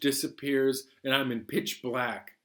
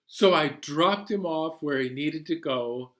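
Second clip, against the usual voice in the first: plain reading voice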